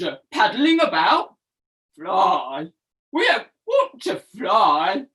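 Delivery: low, scoffing turtle voice; dismissive turtles. Acted. Other turtles reply dismissively.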